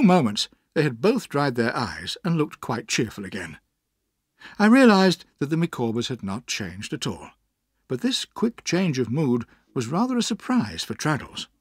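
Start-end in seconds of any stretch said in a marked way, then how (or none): none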